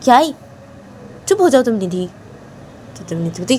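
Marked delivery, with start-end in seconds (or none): none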